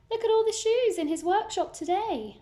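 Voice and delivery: high, excited "look at all"; delighted aside. Acted. Storyteller showing a child the pile of shoes, high and delighted. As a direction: point it out with wonder.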